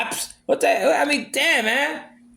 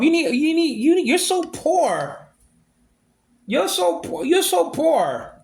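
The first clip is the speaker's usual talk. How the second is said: mocking; 5 semitones higher, slower than usual. Real, not acted. Ranting at the absent wife.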